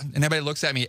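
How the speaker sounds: ordinary talk, nothing marked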